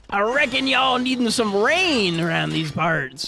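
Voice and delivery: high, slow hammed-up Texan accent; mock Texan drawl. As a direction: play a lightly voiced cowboy Kyogre in a Texan accent. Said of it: "Kyogre be like".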